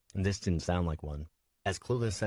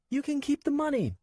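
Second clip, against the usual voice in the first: put-on tearful child; 3 dB louder than usual. Nico dropped off alone, high tearful child. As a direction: hold back tears as a scared boy. Boy bargaining.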